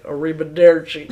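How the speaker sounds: put-on loud Southern-accented Italian, laughing; mock Tennessee Italian. Host quoting the film as she and mom do, Tennessee accent. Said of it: "my mom and I are always like".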